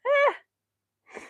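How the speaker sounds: sudden high yelp; a quick surprised shriek; startled yelp. Real, not acted. Startled by him.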